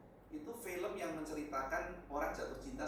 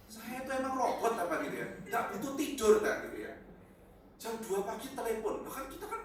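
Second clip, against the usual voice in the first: indignant; 7 semitones higher, 8 dB louder than usual. Real, not acted. Pastor outraged at 2 a.m. calls.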